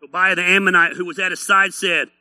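ordinary talk, nothing marked

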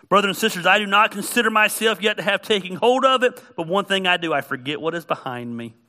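loud, high, driving scripture reading; fiery sermon reading; preaching at full force. Acted. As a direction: proclaim Paul's words to the congregation.

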